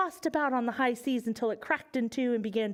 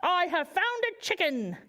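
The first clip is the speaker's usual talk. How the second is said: lightly voiced pirate; 7 semitones higher than usual. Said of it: "shouted a one-armed pirate".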